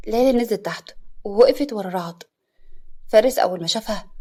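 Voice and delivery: ordinary delivery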